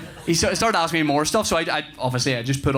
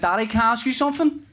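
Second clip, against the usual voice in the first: put-on higher, quick child's question; imitated young son; 7 semitones higher, faster than usual. Son asks dad while driving, imitated child. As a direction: put on a little boy's asking voice.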